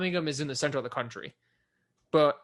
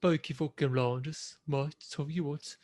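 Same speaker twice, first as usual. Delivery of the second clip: slow Brummie drawl; Brummie accent. Acted. Mimicking Peaky Blinders for the co-host, slow Brummie accent. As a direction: put on a drawn-out Birmingham accent. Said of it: "Peaky Blinders at Birmingham".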